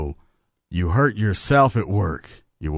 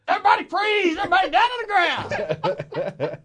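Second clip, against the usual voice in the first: robber shout. Pretend.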